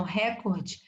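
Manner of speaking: "recorde" stressed on first syllable, open RÉ. Shown as a demonstration. Teacher showing the mistaken proparoxytone, "recorde" stressed on RÉ. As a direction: hit RÉ at the start of "recorde". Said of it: "mas a pronúncia está errada".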